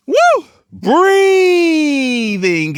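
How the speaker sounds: high, drawn-out whooping, slowly sliding down; exuberant whoop. Real, not acted. Vlogger out in nature breathing in prana, exuberant drawn-out whoop. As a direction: whoop it out and stretch "breathing" long.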